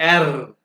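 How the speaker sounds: एर ending in a tongue-trilled r; vibrated German R. Shown as a demonstration. Teacher asks students to vibrate the tongue, rolled एर. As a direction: roll the r of एर firmly and loudly. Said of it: "यहाँ पे जुबान को vibrate करें".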